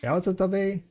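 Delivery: bright, loud, high hello; excited greeting. Real, not acted. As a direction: greet him with a burst of energy. Greeting chatter loudly.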